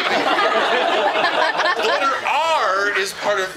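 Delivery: very slow, loud, laughing over-enunciation; mock lesson. Agent lecturing the rude caller very slowly and loudly. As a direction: spell it out like she's a child.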